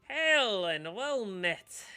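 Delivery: slow booming shopkeeper greeting; gnome greeting. Acted. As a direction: hail them grandly, drawn out. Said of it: "raising a hand and saying".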